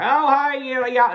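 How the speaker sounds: put-on high, loud caricature of a Southern accent; mock Southern accent. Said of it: "it's not your basic".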